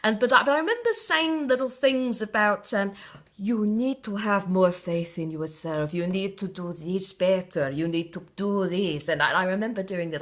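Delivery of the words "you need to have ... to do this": a mock Spanish mystic laying down advice in a bad accent; horrible Spanish accent. Teen fortune teller advising a customer, in an exaggerated fake Spanish accent. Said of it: "apologies to all the Spanish watching for the horrible accent".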